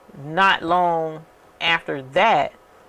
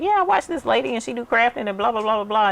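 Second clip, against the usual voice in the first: much higher, chatty imitation of a gushing fan; imitating the viewer; 7 semitones higher, faster than usual. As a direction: put on a high bubbly fan voice for the friend. Imitates the viewer talking to auntie.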